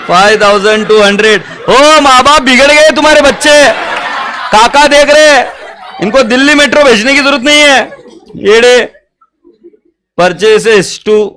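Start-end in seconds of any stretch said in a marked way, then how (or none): 1.7-7.8 loud, high, laughing mock rebuke; mock scolding. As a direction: tease them like a shocked uncle, much higher and louder. Mock scolding spoilt students, loud. (ज़ोर से, ऊँची आवाज़ में हँसते हुए नकली डाँट)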